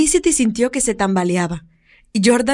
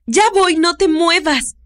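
higher, louder, slow urgent call; 8 semitones higher, 3 dB louder, slower than usual. Acted. Woman runs to the girl, urgent higher call. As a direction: call out as you run. Running to the falling child.

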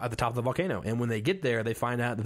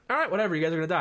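higher, louder, shrugging; mock dismissive warning; 6 semitones higher, 4 dB louder, much faster than usual. Imagining warning the villagers, shrugging and loud. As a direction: toss off the warning, not caring. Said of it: "I'm like".

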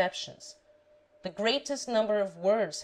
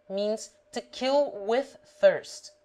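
normal talking voice

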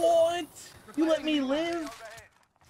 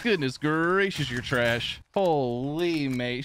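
ordinary delivery

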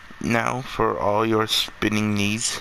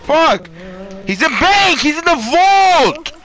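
very high, loud screaming; panicked yelling; 7 dB louder, slower than usual. Real, not acted.

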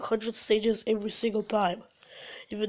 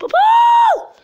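very high, very loud shriek; sudden piercing scream at a failed jump; frustrated scream; 11 dB louder than usual. Real, not acted. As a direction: shriek at full volume.

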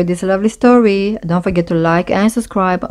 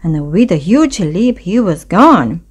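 usual speaking voice

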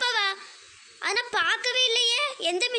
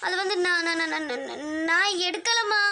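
lower, slow, crying and stammering; tearful denial; 4 semitones lower than usual. Acted. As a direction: sob out a denial to mother. Child cries denying guilt.

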